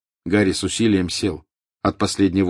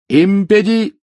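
high, loud shouted incantation; shouted spell; 13 semitones higher, 9 dB louder, much slower than usual. A narrator's reading. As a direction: yell the spell.

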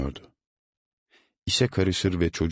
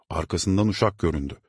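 ordinary delivery

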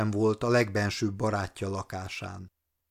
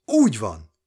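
cheerful call; 5 dB louder than usual. A narrator's reading. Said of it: "kiáltotta neki vidámodva".

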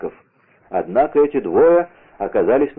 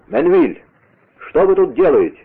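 higher, raised questioning call; shouted challenge; 7 semitones higher, 4 dB louder than usual. A narrator's reading. D'Artagnan calling from the window to the gang leader, shouted challenge. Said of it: "крикнул он".

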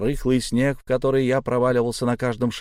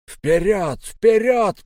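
put-on old man roaring, the second call louder and higher; yelling; 10 semitones higher, 5 dB louder, much slower than usual. A narrator's reading. Old man urging the boarders on, roaring. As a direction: bellow the order forward, pushing harder the second time. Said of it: "проорал откуда-то спереди старик".

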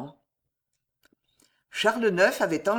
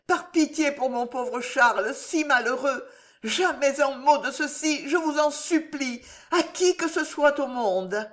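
imploring mistress; 7 semitones higher than usual. Acted. Marie begs Jeanne's silence.

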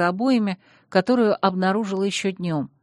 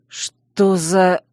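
startled; 5 dB louder than usual. A narrator's reading. Duke startled.

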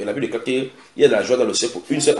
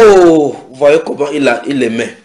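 exclaiming onlookers; 4 semitones higher, 10 dB louder than usual. Retold or imitated. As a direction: cry out like a struck crowd. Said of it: "les Juifs disent".

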